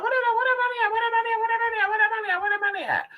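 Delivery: very high, repeated frantic question; frantic thinking. Retold or imitated. Searching the room for her money, frantic and high. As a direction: loop the question in rising panic. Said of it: "I'm sitting there thinking, like".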